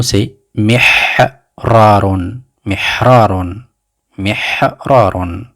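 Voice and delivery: mihrarun, slow and careful, with a breathy h; teacher modelling mihrarun syllable by syllable; Arabic word demo. Shown as a demonstration. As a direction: spell out mihrarun slowly for learners. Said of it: "Le deuxième mot".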